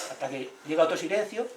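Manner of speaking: usual speaking voice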